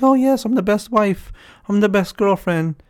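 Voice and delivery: hammed-up best-wife gush; mock proud wife. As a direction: preen like a proud devoted wife. Voicing proud partner-servant.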